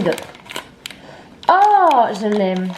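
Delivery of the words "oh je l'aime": delighted gasp. Real, not acted. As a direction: gasp with joy at the gift. Unboxing gift from best friend.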